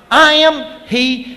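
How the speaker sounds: high, slow, loud proclamation; ringing, drawn-out declaration; mighty declaration. Retold or imitated. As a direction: proclaim it with divine authority. Jesus declares himself to soldiers.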